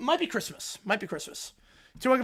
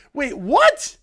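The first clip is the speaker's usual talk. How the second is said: louder startled villain; mock surprise; 4 semitones higher, 9 dB louder than usual. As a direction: catch yourself off guard. Villain caught off guard.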